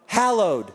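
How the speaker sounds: "Hallowed" opening on "hal", not "hol"; corrected pronunciation. Shown as a demonstration. Preacher trying the corrected word, "Hallowed" with a short a. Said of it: "Is that how you say it?".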